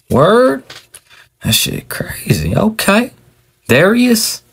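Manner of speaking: high, disbelieving "Word?" and "Darius?"; stunned surprise. Acted. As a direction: caught off guard by the same name, voice jumps up. Son stunned by the same name.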